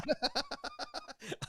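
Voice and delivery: real high-pitched burst of laughing; high laughter. Real, not acted. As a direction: crack up helplessly at the story. Laughs at his own story.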